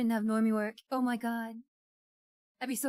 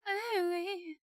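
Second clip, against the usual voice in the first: cute voice imitation; 7 semitones higher than usual. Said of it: "with that cute voice".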